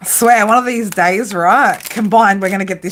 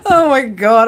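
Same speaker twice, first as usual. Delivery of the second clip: ordinary talk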